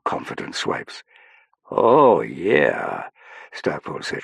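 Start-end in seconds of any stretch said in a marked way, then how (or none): 1.7-3.1 grinning, drawn-out approval. A narrator's reading. Stackpole approving of Saffron's lipstick, grinning.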